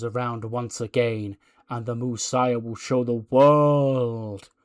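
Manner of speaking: slow, ominous preacher's promo; Messiah. Acted. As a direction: pronounce judgement with long drawn-out words. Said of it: "He goes".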